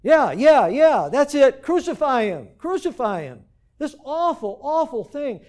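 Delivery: loud, high mob yelling for blood; high, loud chant of an angry crowd; frenzied crowd shout. Acted. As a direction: whip it up like a baying mob. Preacher voices the frenzied crowd.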